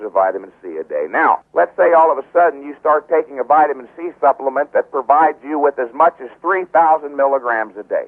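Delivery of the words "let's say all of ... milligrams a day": ordinary talk, nothing marked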